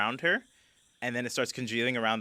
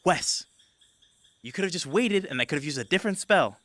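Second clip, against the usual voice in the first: Greta voiced; 6 semitones higher than usual. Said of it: "And Greta goes".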